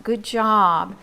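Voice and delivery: ordinary talk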